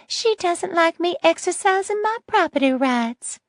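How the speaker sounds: put-on high, sweet little old lady. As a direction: innocent sweet grandma tone. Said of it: "the sweet little old lady voice back in place".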